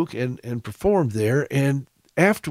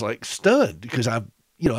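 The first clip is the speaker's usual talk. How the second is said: ordinary delivery, nothing marked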